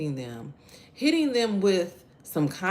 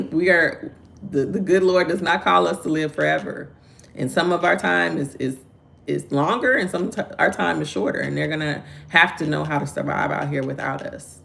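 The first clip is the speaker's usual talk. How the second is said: louder, raised preaching to parents; 2 semitones higher, 5 dB louder than usual. Real, not acted. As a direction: press the point like a sermon. Continues preaching.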